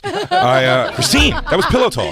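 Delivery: high, laughing plea; a high laughing appeal to Christine; laughing protest. Real, not acted. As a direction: squeal it through laughter.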